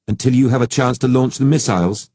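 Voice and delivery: pointed, challenging question; sharp question. Acted. Angela challenging the soldier's plan, sharp. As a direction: cut him off with it. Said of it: "Angela asked sharply".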